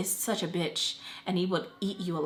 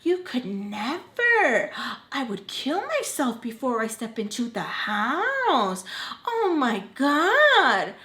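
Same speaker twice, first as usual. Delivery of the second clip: grandma's disgusted tone; 5 semitones higher, slower than usual. Retold or imitated. Imitating grandma refusing to enter the house, slow and disgusted. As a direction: ham up the grandma's horror. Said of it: "That's how she's saying it".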